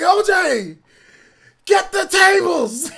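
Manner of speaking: high, loud, slow wrestling call; wrestler shout. Retold or imitated. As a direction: bellow it like a ring announcement. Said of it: "John would be like".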